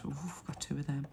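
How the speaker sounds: fast, breathy hushed whisper. Real, not acted. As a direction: whisper it quickly to yourself. Excited whisper while scratching.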